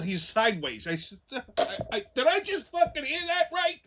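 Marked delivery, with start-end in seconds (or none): 2.1-3.8 incredulous. Retold or imitated. Stunned question to his buddy.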